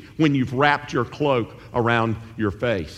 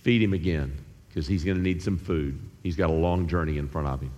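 lower, softer tender command; God's gentle instruction, lower and softer; God's quiet order; 8 semitones lower, 6 dB quieter, faster than usual. Retold or imitated. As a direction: murmur the care to the angel. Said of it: "the Lord sends that angel again".

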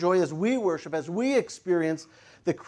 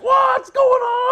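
high, slow, stretched holler; loud, drawn-out excited cry; shouting out for joy; 9 dB louder, slower than usual. Acted. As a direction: yell it in acted amazement. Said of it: "they started shouting out for joy".

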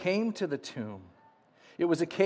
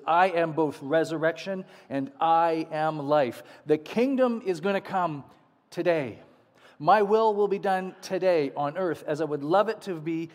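fervent preaching; 2 semitones higher, 4 dB louder than usual. Acted. Preaching Jesus' words forcefully.

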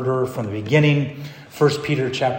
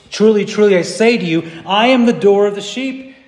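raised, louder declaration; preaching scripture; 8 semitones higher, 8 dB louder than usual. Acted. Preacher quotes Jesus to the church, ringing proclamation. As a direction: proclaim the verse with authority. Reads Jesus' words forcefully.

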